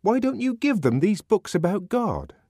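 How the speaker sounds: protesting question, pitched right up; indignant. A narrator's reading. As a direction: press the question in a high, indignant voice. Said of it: "asked the savage indignantly".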